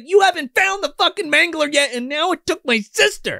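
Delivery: co-host mocking Sonya yelling at the cop; mock angry woman. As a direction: ham up her outrage. Sonya voiced angrily in recap.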